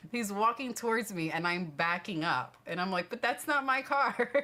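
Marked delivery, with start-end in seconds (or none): 3.1-4.3 high, loud protest of disbelief; excited disbelief. Retold or imitated. To the grinning parking attendant, high loud disbelief. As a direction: insist it can't be your car, half laughing. Backing away from insistent attendant.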